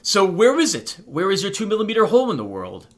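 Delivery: high, loud, eager asking; excited question. Acted. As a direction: ask eagerly, bursting to know. Excitedly asking about the discovery.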